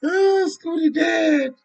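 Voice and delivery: high, slow hammed-up wailing; mock wail. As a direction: drag out a fake mournful cry, high and slow. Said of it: "people be crying".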